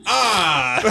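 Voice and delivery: high startled ah; startled gasp. Retold or imitated. Secretaries caught gossiping, high startled ah. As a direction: squeak out a caught-off-guard ah. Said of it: "they're like".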